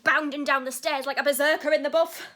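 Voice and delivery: building to a loud, excited finish; rising comic alarm. Acted. Telling of dad charging downstairs, loud and building. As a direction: belt out the berserker image.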